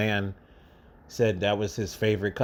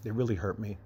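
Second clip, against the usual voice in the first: low, halting, with long heavy pauses; really fighting tears, struggling to get the words out; holding back tears; 3 semitones lower, 5 dB quieter than usual. Real, not acted. Man recalling a grieving young man's loss, trying not to cry. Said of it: "I'm trying not to cry".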